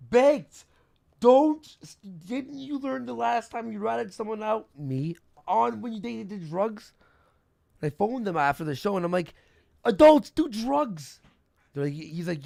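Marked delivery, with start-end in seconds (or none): 0.1-6.9 exasperated scolding. Retold or imitated. Scolding a streamer who ratted someone out, exasperated. 9.9-11.2 high, loud, drawn-out protest; exasperated outburst. Retold or imitated. Phoning a streamer after the show, loudly exasperated. Acted exasperated phone call.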